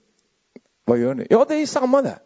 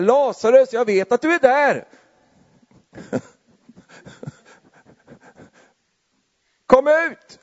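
calling into the tomb; 11 semitones higher than usual. Acted. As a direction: shout the name into the grave. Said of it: "står Jesus och ropar in i det där hålet".

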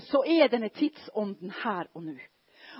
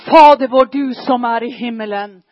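the Lord's Prayer cried out loud; kneeling on the mountain, shouting the prayer out; shouted prayer; 3 semitones higher, 11 dB louder than usual. Retold or imitated. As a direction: call the Lord's Prayer out with full force. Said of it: "jeg bare roper".

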